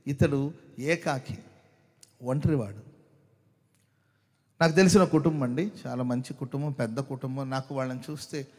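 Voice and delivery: ordinary delivery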